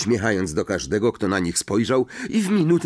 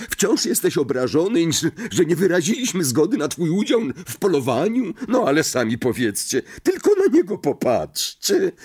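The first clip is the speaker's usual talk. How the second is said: high-pitched public teasing; lively, scoffing showman; boisterous mocking ghost; 9 semitones higher than usual. A narrator's reading. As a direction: jeer and appeal to the onlookers. Sir Patryk publicly mocks Nick.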